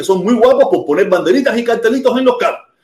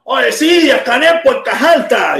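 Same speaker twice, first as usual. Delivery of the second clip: raised, swaggering mock slogan; Miami tough-guy attitude; 6 semitones higher, slower than usual. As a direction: imitate a cocky activist calling out, high-pitched. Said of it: "con la actitud de los maceos de Miami".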